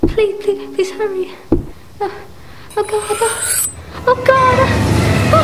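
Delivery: high, crying, rising to a scream; desperate terror peaking; frantic pleading. Acted. Invalid as the killer arrives, frantic screaming pleas.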